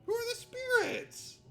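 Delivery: high, loud mock cry of confusion; mock exasperated shout. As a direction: yell the question in fake despair. Repeats question louder, higher.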